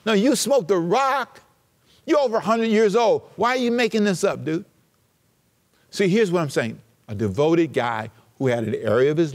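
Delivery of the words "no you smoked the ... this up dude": higher-pitched mock disbelief; incredulous retort. Preacher talking back to Moses' excuse, incredulous. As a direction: call him out, high and incredulous.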